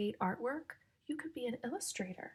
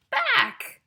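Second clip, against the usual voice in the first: much higher, much louder squeal; squealed shout; 15 dB louder than usual. Acted. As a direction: shriek the rhyme word. Punchline rhyme word shouted high.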